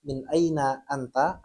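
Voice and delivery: normal talking voice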